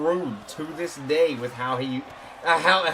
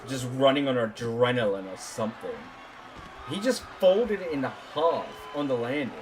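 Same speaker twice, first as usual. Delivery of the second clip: wrestling commentator; 6 semitones lower, 6 dB quieter than usual. Acted.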